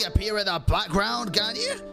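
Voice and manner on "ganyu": ordinary delivery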